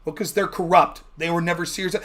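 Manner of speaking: ordinary talk, nothing marked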